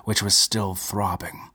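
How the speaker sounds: ordinary delivery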